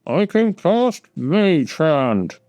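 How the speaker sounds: slow, muffled from inside a bag. Acted. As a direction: speak as if shut inside a bag. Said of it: "You hear muffled from inside the bag".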